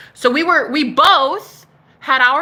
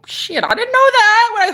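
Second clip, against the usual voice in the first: mock surprise; 11 semitones higher, 4 dB louder than usual. Real, not acted. Realizing he's her twin flame.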